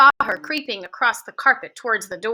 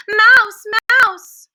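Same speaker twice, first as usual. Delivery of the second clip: higher, louder cry of alarm; alarmed shout; 9 semitones higher, 7 dB louder than usual. A narrator's reading. Witches who would cry out on spotting the mouse, alarmed.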